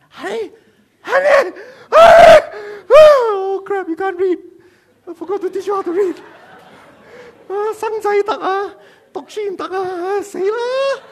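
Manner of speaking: very high, loud hysterical wail; mock panic. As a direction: wail like a husband in comic despair. Husband panics, wife cannot read.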